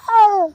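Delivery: high, loud anguished scream. Retold or imitated. Speaker breaking down at the news, screaming. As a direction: scream out in distress. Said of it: "And I started screaming".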